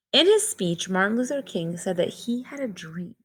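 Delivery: clear, measured read-aloud storybook voice; reading to children. Acted. Teacher reads picture book aloud.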